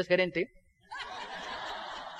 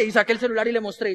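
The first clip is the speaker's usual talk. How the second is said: normal talking voice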